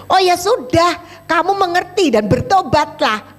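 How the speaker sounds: preacher urging her friend to repent. Retold or imitated. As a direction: press her warmly but firmly to repent.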